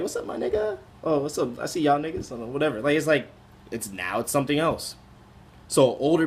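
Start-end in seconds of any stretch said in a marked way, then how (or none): 0.0-2.3 high airy casual greeting among friends; playful high-pitched buddy greeting; friendly greeting. Retold or imitated. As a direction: toss off a friendly hello. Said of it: "if they're just like".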